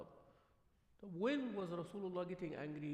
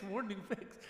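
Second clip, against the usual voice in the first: normal talking voice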